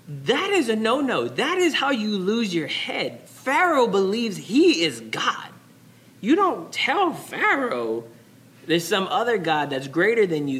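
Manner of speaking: high-pitched, forceful preaching; emphatic warning. Real, not acted. Preacher warns how you lose your head, high emphatic alarm.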